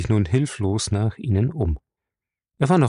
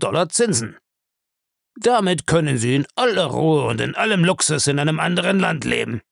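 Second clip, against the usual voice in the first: higher-pitched, insistent dealmaker; Bellamy, persuasive; 7 semitones higher than usual. Acted. Bellamy offers Savini money for a service, urgent. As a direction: sell the offer point by point, pressing.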